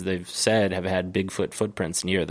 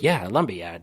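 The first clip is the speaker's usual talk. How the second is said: "lumber yard" with the R sounds dropped, "lumbi yahd"; lightly voiced New England "Yeah, a lumber yard"; New England accent. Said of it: "I'm like".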